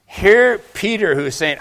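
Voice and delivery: ordinary talk, nothing marked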